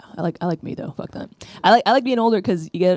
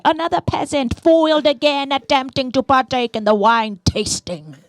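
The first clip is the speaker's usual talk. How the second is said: higher, grand mock announcement; mock announcer headline; 8 semitones higher, 3 dB louder than usual. Snobs' website gloats about the peasant in a high grand voice. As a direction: proclaim it like a pompous headline.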